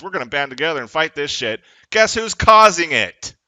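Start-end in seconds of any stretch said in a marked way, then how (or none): 1.9-3.3 loud, slow furious demand; angry shout. Real, not acted. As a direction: yell it at the cops. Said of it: "angry rant peak".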